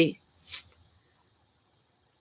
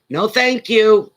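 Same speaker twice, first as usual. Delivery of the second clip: high, loud, slow refusal; emphatic refusal; 7 semitones higher, 7 dB louder, slower than usual. Retold or imitated. Reacting to eel squirming in the pan, emphatic retold refusal. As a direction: turn it down firmly, stretching the words out high.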